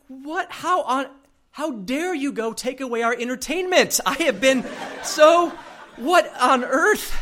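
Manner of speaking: high, loud indignant outburst; hammed-up outrage, building to slow, drawn-out words; mock anger. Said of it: "I got a little bit angry".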